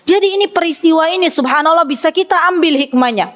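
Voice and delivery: ordinary talk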